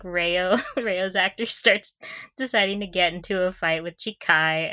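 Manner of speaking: ordinary delivery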